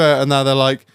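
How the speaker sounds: ordinary talk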